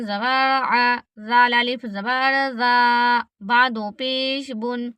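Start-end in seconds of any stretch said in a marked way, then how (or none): none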